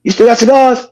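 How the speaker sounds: high excited greeting; retold call. Telling how he phoned his friend, high and eager. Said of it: "I said".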